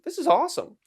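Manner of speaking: high, loud burst of glee; sudden high-pitched delight; excited. Real, not acted. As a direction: blurt it out thrilled. Excited about stand-up.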